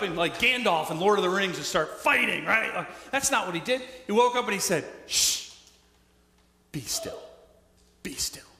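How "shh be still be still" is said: calm hush. Acted. Jesus calming the wind and waves, gentle hush. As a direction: settle the sea like soothing a child.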